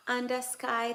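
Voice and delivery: ordinary talk, nothing marked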